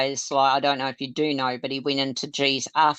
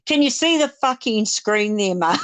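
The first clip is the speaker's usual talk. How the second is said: higher, snapping in imitated frustration; mock exasperated outburst; 7 semitones higher, 3 dB louder than usual. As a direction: finally lose patience, half-hammed. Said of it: "He finally said".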